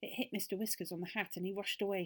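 usual speaking voice

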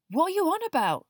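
high, loud, startled question; surprised Ron. A narrator's reading. Boy questioning friend's outburst, surprised. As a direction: ask in open surprise. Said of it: "said Ron in surprise".

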